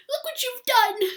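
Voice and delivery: high, loud, joyful cry through tears; a sudden cry of happy tears; delighted exclaim. Acted. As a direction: burst out in teary joy.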